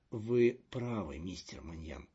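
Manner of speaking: usual speaking voice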